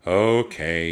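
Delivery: loud, grudging agreement; resigned but audible groan; reluctant groan. A narrator's reading. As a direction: groan it out, giving in. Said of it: "Jack groaned".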